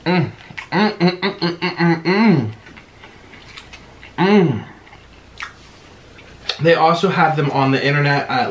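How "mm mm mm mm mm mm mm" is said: high, pleased mm-mm of tasting; savoring hums. Real, not acted. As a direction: hum with delight at the bite. Savouring food noises.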